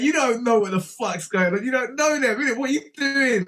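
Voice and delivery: disbelieving outburst; exasperated. Retold or imitated. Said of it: "And I'm like".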